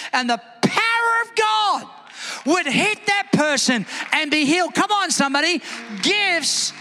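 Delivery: high, driving preacher's cry building to a shout; impassioned revival preaching; full-force preaching. Acted. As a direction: rouse the congregation, pitched high.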